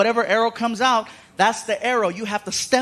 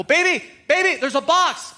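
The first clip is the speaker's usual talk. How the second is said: warning call; 7 semitones higher than usual. Retold or imitated. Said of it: "and I go".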